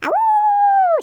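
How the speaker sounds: high, loud howling wolf cub; baby wolf howl. Shown as a demonstration. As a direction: throw your head back and howl high. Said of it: "Come on and ah, woo with me".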